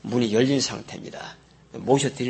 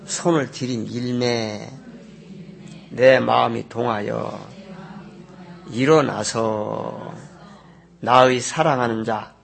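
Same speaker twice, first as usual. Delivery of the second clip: slow, measured Bible reading; scripture reading aloud; slower than usual. Acted. Pastor reading the Song of Songs verse, slow and solemn. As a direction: read the verse slowly and solemnly.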